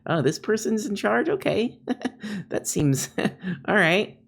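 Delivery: mock resigned employee; a hint of giggling disbelief; sarcastic dismay. Sarcastic quote of bad boss.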